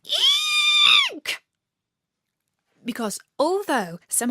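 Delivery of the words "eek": shrill-free tiny squeak of fright, very high and loud; high squeal. Acted. Narrator squeaking in fright at the rat. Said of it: "I said".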